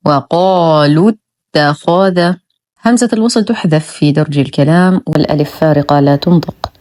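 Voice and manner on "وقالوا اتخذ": slow وقالوا اتخذ, short "lu" into doubled ت; joined recitation. Shown as a demonstration. Teacher reciting the join, وقالوا اتخذ without long waw. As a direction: chant وقالوا اتخذ as "waqaalut-takhadha". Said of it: "ننتقل من ضمة اللام إلى تشديد التاء".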